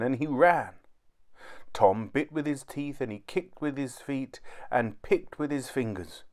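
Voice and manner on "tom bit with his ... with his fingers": ordinary delivery, nothing marked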